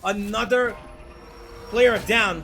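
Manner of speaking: rising hype, fast and loud; esports caster. Real, not acted. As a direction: call the brawl with building excitement. Caster hype on a big play.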